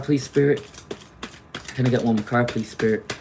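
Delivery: ordinary talk, nothing marked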